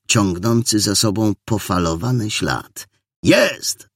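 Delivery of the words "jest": high, sudden cry of discovery; calling out. A narrator's reading. Young militiaman spots the target, calling out. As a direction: call out the sighting, high and quick. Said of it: "zawołał młody milicjant".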